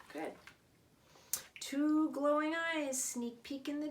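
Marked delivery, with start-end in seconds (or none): none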